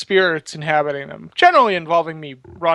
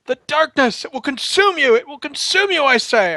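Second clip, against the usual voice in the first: high, hammed-up doom-laden pleading; dramatic warning; 8 semitones higher than usual. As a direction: warn of the darkness like a melodramatic sage. Hammy lore warning to Max.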